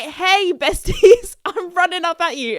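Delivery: high, loud, playful hail; gleeful greeting. Acted. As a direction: call out cheekily to your besties, half laughing. Acted greeting to charging cows.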